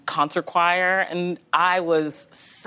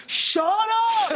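high-pitched mock yell of an annoyed brother. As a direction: imitate a sibling yelling, pitch shot way up. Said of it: "would always be, like, yelling".

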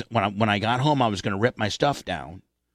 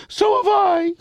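put-on high, louder little-kid voice; childish reply; 5 dB louder than usual. Young son answering his dad in a high kid voice. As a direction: squeak it like a seven-year-old.

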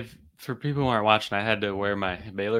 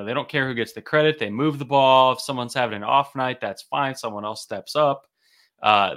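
ordinary talk, nothing marked

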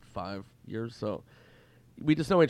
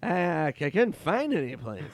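pitched-up impression of the comedian; Norm Macdonald; 6 semitones higher, 4 dB louder than usual. Norm admitting he found nowhere, raised impression voice. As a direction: put on his higher voice. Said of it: "he goes".

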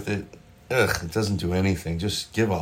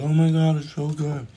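high, gushing fake praise; faked enthusiasm; 8 semitones higher than usual. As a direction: pretend to love the food. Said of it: "doing a good enough job of faking it".

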